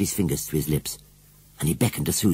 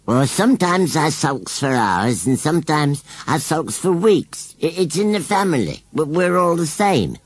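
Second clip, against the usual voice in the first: sulky scarecrow; 9 semitones higher, 6 dB louder than usual. A narrator's reading. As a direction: grumble stubbornly as a country scarecrow. Scarecrow explains his sulks.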